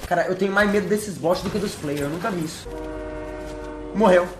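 ordinary talk, nothing marked

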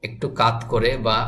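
ordinary delivery

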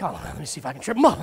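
low, quick mock pondering; mumbled hunt for an answer, sinking very low; thinking to oneself. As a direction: imitate someone puzzling it over under their breath. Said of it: "you're all trying to think".

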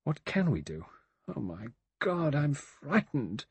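helplessness rising into fear; helpless. A narrator's reading. Vera admits fear to Lombard, helpless. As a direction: rise in pitch, drawing out the last words. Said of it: "she said helplessly".

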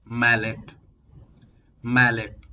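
ordinary talk

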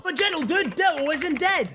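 high nagging voice; squeaky heckler. Acted. Heckler voice again.

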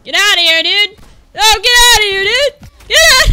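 loud protest climbing in pitch; exasperated shouting. Real, not acted. Gamer telling opponent get outta here, escalating shout.

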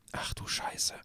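whispered curse; cursing under breath. Real, not acted. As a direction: hiss it under your breath. Cursing at scary stairs.

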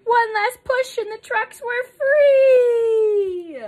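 loud, high, drawn-out final word; slow, exultant storybook payoff; triumphant climax. A narrator's reading. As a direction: stretch the last word into a cheer.

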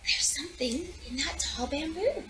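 hushed storybook whisper; soft mysterious whisper. A narrator's reading. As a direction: breathe it like a secret. Hushed opening line of story.

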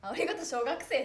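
loud laughing retort; laughing quote. Retold or imitated. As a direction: blurt it out through laughter. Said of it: "って言われた".

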